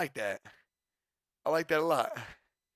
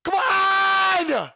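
much higher and louder cry of disbelief; exasperated shout; 11 dB louder than usual. Real, not acted. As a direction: yell it at the ceiling. Outburst at oversold jersey.

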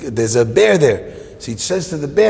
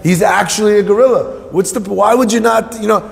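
high-pitched acted protest; worked-up storyteller's argument; arguing; 6 semitones higher than usual. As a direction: plead your case in exasperation. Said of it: "And they're arguing, arguing".

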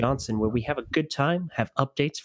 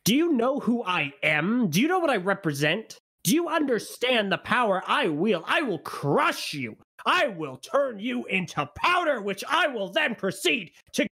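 higher hammed-up conquering tyrant rant; barbarian; 9 semitones higher than usual. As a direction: boom like a warlord threatening crushing. Host rants as tyrant, mock threat.

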